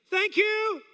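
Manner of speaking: high, bewildered thanks; puzzled, high-pitched politeness; bemused call back. Retold or imitated. Awkward reply to a shouted invitation.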